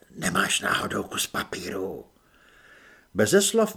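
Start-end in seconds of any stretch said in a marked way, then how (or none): 0.1-2.1 a hint of a low, casual stranger; lightly voiced unseen helper asking a favour; hidden adviser. Voice behind wall asking Apoléna for paper, lightly low.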